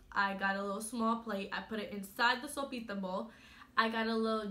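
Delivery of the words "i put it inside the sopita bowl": usual speaking voice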